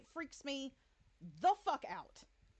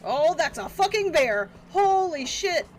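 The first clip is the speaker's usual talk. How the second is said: loud panicked shouts; panicked yelling; 3 semitones lower, 8 dB louder than usual. Real, not acted. Gamer running from a bear, loud panicked yelling. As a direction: yell while fleeing in panic.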